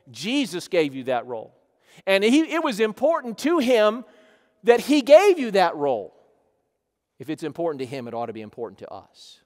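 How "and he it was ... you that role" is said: loud, high pulpit exhortation; emphatic preaching. Real, not acted. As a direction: drive it home with a raised voice. Preaches at raised force.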